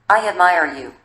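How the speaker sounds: plain reading voice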